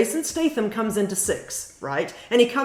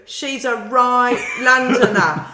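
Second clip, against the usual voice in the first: slow Cockney Londoner through a laugh; mock Cockney; 6 dB louder, slower than usual. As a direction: lay the Cockney on thick, laughing. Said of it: "this will surprise you too".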